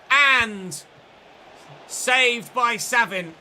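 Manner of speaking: loud, drawn-out cry; yelled as the keeper saves it; excited shout. Real, not acted. Gamer calling his own shot being saved, loud and slow.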